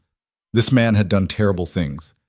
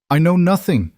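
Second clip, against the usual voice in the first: voice cracking; 9 semitones higher, 4 dB louder than usual. A narrator's reading. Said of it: "Her voice cracked".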